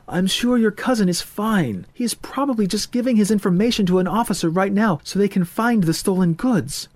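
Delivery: reassuring mother. Acted. Voices Missus Solomon.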